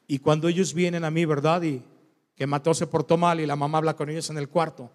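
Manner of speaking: usual speaking voice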